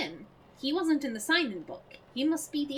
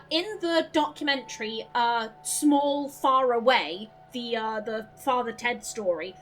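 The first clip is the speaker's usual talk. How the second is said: normal talking voice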